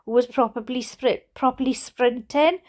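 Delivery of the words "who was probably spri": ordinary delivery, nothing marked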